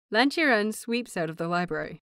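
ordinary delivery, nothing marked

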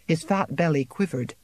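ordinary delivery

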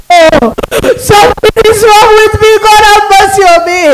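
loud, high plea for mercy; anguished cry. Retold or imitated. Sinner in hell begging God, anguished cry. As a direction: cry out in desperate distress.

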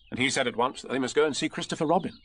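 ordinary delivery

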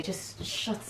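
hissed under breath; whispering. Real, not acted. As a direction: hush them in a whisper. Hushing sister under breath.

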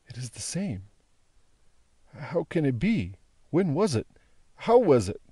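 put-on an old captive piecing it together in wonder; bewildered prisoner, amazed. Prisoner marvels at the hair.

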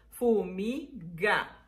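"fumiga" slowly as fu, mi, ga; syllable by syllable. Shown as a demonstration. Teacher showing three syllables, "fumiga" slowly split. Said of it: "Tres sílabas".

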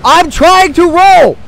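high, loud, drawn-out protest; stretched-out exasperated shout; frustrated yell. Real, not acted. Frustrated as dodge fails.

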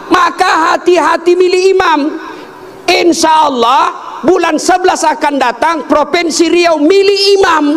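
high, ringing exhortation; full-force preaching. Real, not acted. Preacher warns voters about choosing an imam, real full-force exhortation. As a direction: drive each question and warning home at the top of the range.

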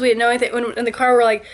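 ordinary talk

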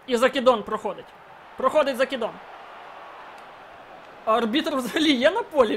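ordinary talk

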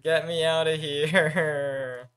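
slow, drawn-out plea; desperate drawl. Real, not acted. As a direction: stretch it out in despair. Desperate to escape.

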